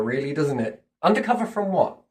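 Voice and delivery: usual speaking voice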